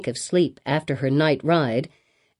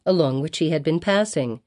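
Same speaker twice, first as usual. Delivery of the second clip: plain reading voice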